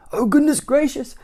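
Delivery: very high, loud hammed-up fright; frightened cry. Mister Jelly hearing the crackling cereal, crying out. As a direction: squeal it in mock terror. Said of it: "cried Mister Jelly".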